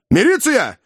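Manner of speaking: high shout into phone. A narrator's reading. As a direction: yell for the police. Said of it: "закричал Иван в трубку".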